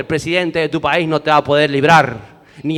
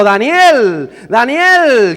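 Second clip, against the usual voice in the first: high, loud cry calling out for Daniel; king's desperate call into the pit; anguished shout; 8 semitones higher, 9 dB louder, much slower than usual. Acted. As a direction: cry the name out in anguish, drawing out the end. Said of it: "gritó a Daniel con voz angustiada".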